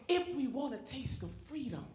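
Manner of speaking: drops from soft to whispered; fading to a whisper. Acted. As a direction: pull it down to a whisper.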